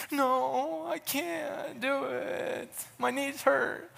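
put-on high, slow, whimpering child voice; whiny kid. Boy refusing coach, whiny and tearful. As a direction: whine like a tearful ten-year-old. Ten-year-old self whining to coach.

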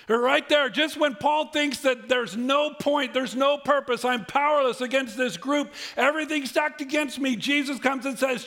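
loud, high-pitched, urgent preaching; impassioned preaching. Acted. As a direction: drive it home to the congregation at full force. Preaches with full force.

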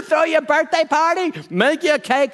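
high, loud mocking singsong voice; mocking ladies. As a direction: tease her in a high, sneering voice. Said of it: "They're making fun of her".

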